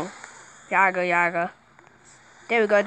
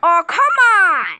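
loud exasperated yell, pitch jumping high; frustrated shout; 11 semitones higher, 11 dB louder, slower than usual. Real, not acted. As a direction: yell it at the screen, fed up. Frustrated moment in game.

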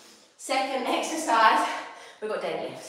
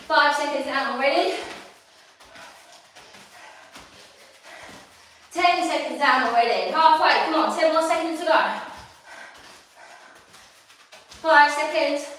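higher, louder urging to hold on; loud encouragement; 5 semitones higher, 5 dB louder than usual. Real, not acted. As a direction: push them through the last seconds. Countdown of the last round.

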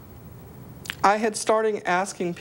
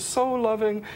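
ordinary talk

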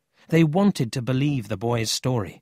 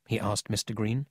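usual speaking voice

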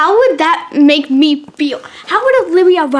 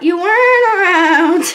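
robot voice; 4 semitones higher, 4 dB louder than usual. Shown as a demonstration. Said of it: "I kinda sound like a robot".

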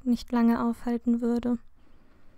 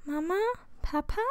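put-on small, questioning child's call; lightly voiced shy little boy; timid; 4 semitones higher, 6 dB quieter, faster than usual. A narrator's reading. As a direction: ask softly, unsure anyone will answer. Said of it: "fragte Harry schüchtern".

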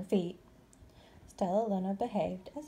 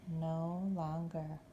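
ordinary delivery

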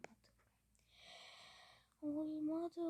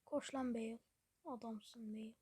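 ordinary delivery

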